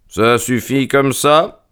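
calme froid, lent et plus aigu; froideur. A narrator's reading. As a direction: question posée froidement, en prenant son temps. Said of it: "dit froidement mon ami". (ton froid et posé)

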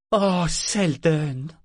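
slow, drawn-out tired dismay; weary, dismayed cry. A narrator's reading. Mother sees her son at the door, slow weary dismay. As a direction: sigh the boy's name, worn out and dismayed. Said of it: "d'un ton las et consterné".

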